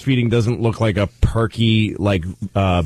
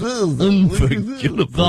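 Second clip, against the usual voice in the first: slow, high, sing-song anchor parody; hammed-up fake news voice; mock newsreader voice; 8 semitones higher, slower than usual. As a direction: stretch it out like a pompous news anchor. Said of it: "The fake voice douche".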